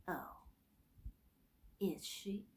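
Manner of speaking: soft, eerie voice of a grim ghostly being; gray terrible voice. Acted. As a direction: speak it quietly, hollow and menacing. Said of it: "That gray and terrible voice".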